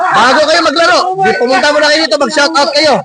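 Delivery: high-pitched call to come over. Real, not acted. Host calls the aunties behind them over for a shoutout, calling out.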